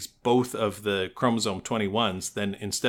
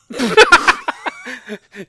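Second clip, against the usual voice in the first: loud high laugh; 14 dB louder than usual. Real, not acted. Laughing at own blunder.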